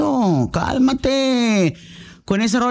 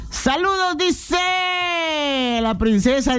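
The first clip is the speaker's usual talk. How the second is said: announcer call; 7 semitones higher, much slower than usual. Real, not acted. Host announces a listener message.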